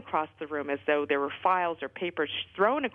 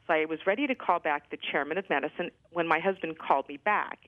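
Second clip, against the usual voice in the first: ordinary delivery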